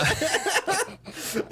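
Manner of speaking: high burst of laughter; high laughing burst. Real, not acted. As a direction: squeal with laughter.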